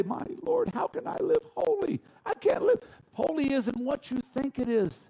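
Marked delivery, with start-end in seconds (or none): 0.0-2.8 high, pleading mock voice; whiny mock plea. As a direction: whine it like a defeated believer. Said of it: "we say".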